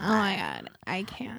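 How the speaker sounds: creaky fry trailing off soft; vocal fry. Pretend. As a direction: let it die out in a creak. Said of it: "Is this vocal fry time?".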